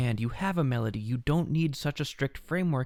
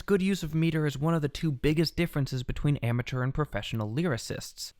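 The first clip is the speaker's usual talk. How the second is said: ordinary talk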